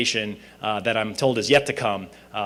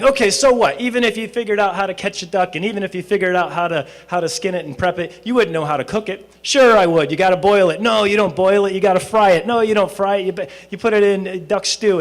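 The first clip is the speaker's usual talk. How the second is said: much higher and louder squabbling back and forth; heated bickering; 9 semitones higher, 7 dB louder than usual. Acted. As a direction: argue like stubborn old friends. Old friends loudly arguing about ducks.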